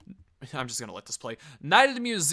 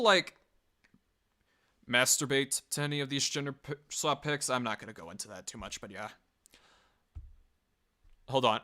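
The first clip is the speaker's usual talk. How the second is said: normal talking voice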